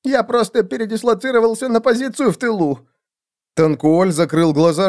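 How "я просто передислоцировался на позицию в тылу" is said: very high, defensive squeak; insistent. A narrator's reading. Assassin explains his retreat to seer, insistent. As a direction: justify yourself, voice pitched way up. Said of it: "настаивал Сумеречный Клык".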